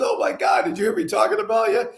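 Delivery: very high, airy shout of surprise, then quick; shocked exclamation. Retold or imitated. Patient spots the speaker in the doorway and is startled. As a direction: caught out, gasping "Oh, my God". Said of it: "He goes".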